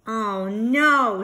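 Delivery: a sudden worried outburst; alarmed. Acted. Mobile plays, baby might wake.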